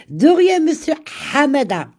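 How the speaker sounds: a cheerful sing-song thank-you; high, lively you're welcome; bright playful thanks. Real, not acted.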